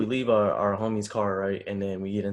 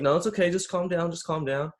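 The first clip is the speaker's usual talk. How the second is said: higher-pitched placating voice, easing in volume; imitated calming down; 7 semitones higher than usual. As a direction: soothe someone in a raised, light pitch. Retold cop soothing nervous speaker.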